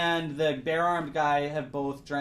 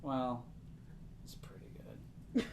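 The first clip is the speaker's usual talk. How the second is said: soft, whispery, understated gnome voice; little old gnome; 3 semitones lower, 5 dB quieter, slower than usual. Acted. Little gnome recommending the blood, quietly understated. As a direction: trail down into a slow whisper, mildly approving. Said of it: "the little gnome's like".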